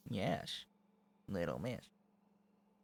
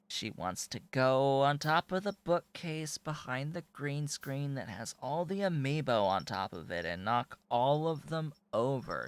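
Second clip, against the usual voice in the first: normal talking voice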